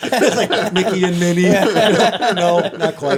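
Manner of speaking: laughing through a silly joke. Real, not acted. Joking to the hosts, laughing. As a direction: giggle out the joke.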